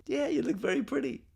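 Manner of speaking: husband playing it safe with a sweet smile; smiling compliment. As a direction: say it through a forced smile. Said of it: "I just smile and say".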